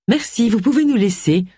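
high-pitched, polite dismissal; dismissive. A narrator's reading. Cassandra sends the housekeeper out, dismissive. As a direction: send the servant away primly. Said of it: "la congédia Cassandra".

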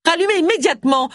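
ordre rageur, très aigu; tempête d'une voix haut perchée, sans hurler; tempêtant. A narrator's reading. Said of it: "tempêta Allison". (colère aiguë, impérieuse)

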